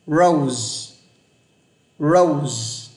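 "Rose" with a curled untrilled r and long o; English Rose. Shown as a demonstration. Teacher modeling "Rose" in English. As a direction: curl the tongue for "Rose". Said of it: "Chữ hoa hồng nhé".

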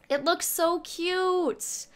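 high, drawn-out coo of delight; gushing squeal. Real, not acted. Streamer gushing over the cute village, high squeal.

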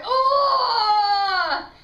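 long loud high wail. Retold or imitated. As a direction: cry out as you bolt from the room. Acting out running out, sick.

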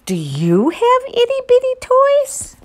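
playful question. Real, not acted. Asking child listener.

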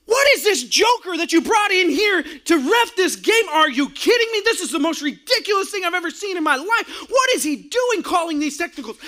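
high, loud hammed-up tirade; angry yell. Pastor yelling at the game officials about the ref, furious. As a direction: rant at the booth about the ref.

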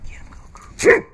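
breathy, high whisper of encouragement; urgent whisper. Real, not acted. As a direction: whisper it urgently, hoping he grabs it. Urging Goku during the catch.

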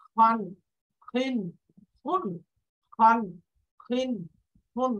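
"খান, খিন, খুন" with a heavy kh; thick kha. Shown as a demonstration. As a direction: drill "খান" with a thick throaty onset. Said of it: "একটু মোটা করে।".

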